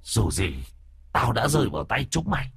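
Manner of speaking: high, ghostly voice of a vengeful demon; eerie demon voice. Acted. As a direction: creep him out with a shrill, uncanny spirit voice. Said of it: "giọng quỷ dị đáng sợ".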